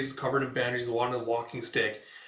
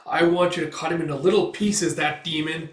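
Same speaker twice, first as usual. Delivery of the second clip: louder, vengeful demand; hateful plea; 4 semitones higher, 6 dB louder than usual. Retold or imitated. Old man begging Guts for revenge, louder hateful tone. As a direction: spit out the revenge wish. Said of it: "it's like".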